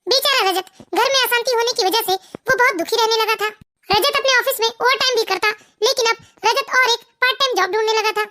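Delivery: ordinary talk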